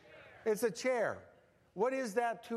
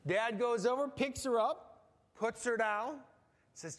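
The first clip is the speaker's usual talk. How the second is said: ordinary talk, nothing marked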